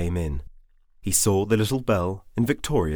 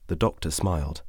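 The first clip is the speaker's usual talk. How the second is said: ordinary delivery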